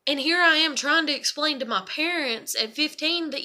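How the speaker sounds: ordinary talk, nothing marked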